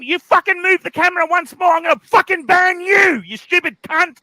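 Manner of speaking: angry shouting. Acted. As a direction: bark threats at the chat, losing it. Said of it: "he's yelling at Sirocco".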